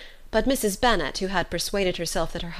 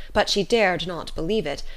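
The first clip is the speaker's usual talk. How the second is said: plain reading voice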